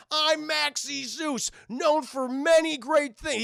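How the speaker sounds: slow, high, loud boastful announcer; grand showman. Retold or imitated. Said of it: "it's like".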